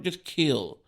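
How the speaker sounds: usual speaking voice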